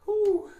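high, loud whooped exhale; real high-pitched whew of relief; relieved whew. Real, not acted. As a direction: let out a loud, high whew after a great stretch. Relief after a big stretch.

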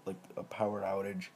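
ordinary talk, nothing marked

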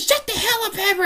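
high, mock old lady snap; grandma scolding. Grandma telling her barking dog to hush, high voice. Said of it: "she says".

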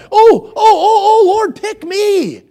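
high, loud, excited begging; eager Isaiah. Acted. Isaiah volunteering to the Lord, eager high-pitched pleading. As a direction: burst into repeated ohs, then stretch out the plea. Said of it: "Isaiah is so eager to be used of the Lord".

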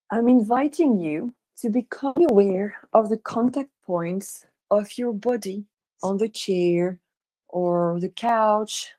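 unhurried meditation guide, long pauses between phrases; guided meditation. Real, not acted. Guiding listeners to relax and imagine, guided meditation. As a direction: lead them gently through it, leaving space after each instruction.

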